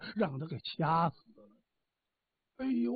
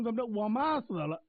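ordinary delivery, nothing marked

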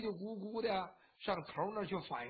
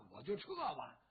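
plain reading voice

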